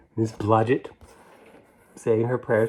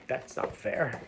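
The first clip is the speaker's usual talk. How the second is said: ordinary delivery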